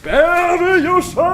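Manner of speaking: high, slow battle cry; attack name shout. Acted. Hero crying out the name of his attack. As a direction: bellow it like a cartoon hero's special move.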